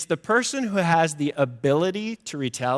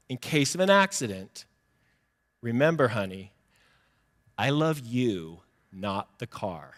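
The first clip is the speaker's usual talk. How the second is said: tender note reading; 5 semitones lower, 4 dB quieter than usual. Retold or imitated. Husband's sticky note to his wife, tender. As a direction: read it tenderly, letting each phrase settle.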